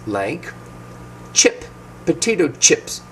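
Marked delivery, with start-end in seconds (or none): 1.3-1.6 "chip" with a brief, relaxed vowel; short I sound. Shown as a demonstration. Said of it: "with the short I".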